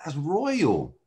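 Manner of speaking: retold sudden realization. Law student realizing what real means, amazed. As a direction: replay the moment it hit you. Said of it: "it hit me, I said".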